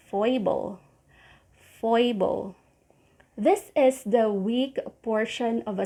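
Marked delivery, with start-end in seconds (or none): 0.1-0.8 foible, said FOI-buhl, stress on FOI; model word. Shown as a demonstration. Teacher modelling foible for learners, slow and clear. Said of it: "you say". 1.8-2.6 foible, said FOI-buhl, stress on FOI; model word. Shown as a demonstration. Teacher modelling foible for learners, slow and clear. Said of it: "you say".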